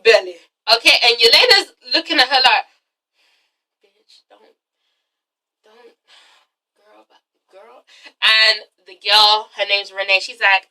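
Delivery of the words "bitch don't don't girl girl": barely audible, low mutter with long gaps; hushed warning. Retold or imitated. Yelena eyeing the pregnant visitor, hushed warning. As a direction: voice a muted warning under the breath, word by word.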